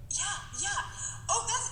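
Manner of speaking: usual speaking voice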